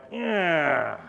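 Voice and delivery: long, drawn-out sleepy groan; reluctant waking groan. Acted. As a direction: groan like you dread getting up. Groans at getting out of bed.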